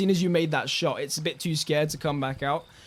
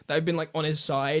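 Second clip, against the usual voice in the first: ordinary talk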